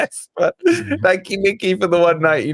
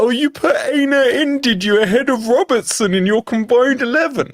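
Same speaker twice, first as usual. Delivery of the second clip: mock indignant critic; 6 semitones higher than usual. Streamer mimicking fans who gave him crap over his team pick, high mocking voice. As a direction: throw the complaint out in a pitched-up, mocking voice. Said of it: "That's not a Scouse accent attempt".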